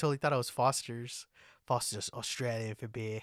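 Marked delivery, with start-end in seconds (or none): 1.7-3.2 softer, mock Australian ad slogan; Australian accent. Co-host likening the sponsor to Foster's, Australian accent. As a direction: imitate an Australian beer advert. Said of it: "he's got that accent".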